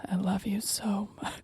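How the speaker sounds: soft breathy whisper. Shown as a demonstration. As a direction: whisper it close in the ear. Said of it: "what I would say right in your ear".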